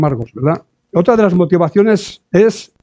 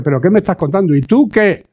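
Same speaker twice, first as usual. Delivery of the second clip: rising offended comeback, dragged out at the end; wounded believer rebuffing correction, indignant and slowing; indignant retort; 4 semitones higher than usual. Retold or imitated.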